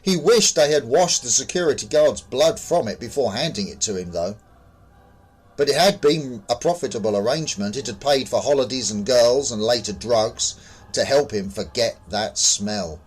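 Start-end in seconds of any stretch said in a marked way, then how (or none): none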